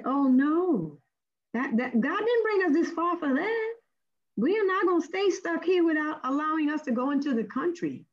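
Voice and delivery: high, defiant refusal; indignant protest. Retold or imitated. Letitia protesting to her leader about the officer, indignant.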